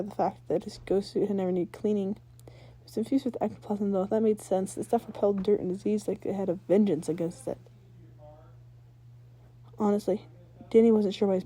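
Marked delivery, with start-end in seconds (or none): none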